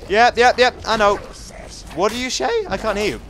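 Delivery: high, loud taunts building to a yell; shouted battle insults. Real, not acted. Player insulting a monster mid-fight, loud taunting.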